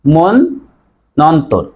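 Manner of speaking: মন নন্তর split as mon... nontor; doubled n. Shown as a demonstration. Teacher shows correct form, broken in two. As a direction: pause between the two n sounds of মন নন্তর. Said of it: "তাহলে উচ্চারণ হবে".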